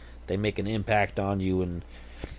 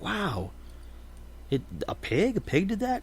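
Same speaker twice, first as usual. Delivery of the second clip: incredulous; 11 semitones higher, 5 dB quieter than usual. Acted. Businessman can't believe pig story.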